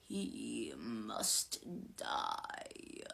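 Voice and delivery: low, very slow, hushed menace; drawn-out sinister wraith voice; possessing spirit. A narrator's reading. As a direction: intone it like a ghostly death sentence. Possessing spirit passes a death sentence.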